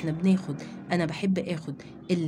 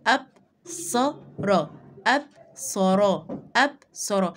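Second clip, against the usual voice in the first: ابصر stretched very slowly, ab then sar; slow classroom reading for children; slow syllable reading; much slower than usual. Shown as a demonstration. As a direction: draw out ابصر, the sukun joined to the alif. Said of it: "بقراها بقول ايه؟".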